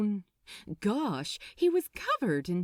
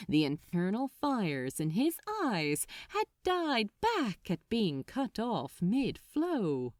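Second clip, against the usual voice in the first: ordinary delivery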